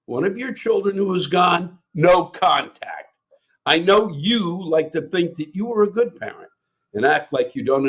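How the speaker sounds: indignant rant reading. Acted. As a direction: read it as an angry open letter. Performed reading of listener's rant.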